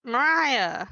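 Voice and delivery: sudden loud, high holler of a name; calling out. Acted. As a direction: call out to get someone's attention. Calling out to someone in the house.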